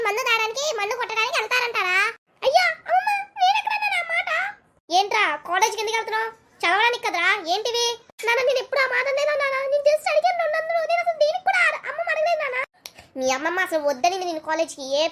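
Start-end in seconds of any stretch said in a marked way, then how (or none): 2.5-4.3 high, whining protest with a laugh; whiny grandson. Acted. Grandson protests to grandma in a high whine. 8.3-12.6 put-on higher whiny boy voice; pleading son.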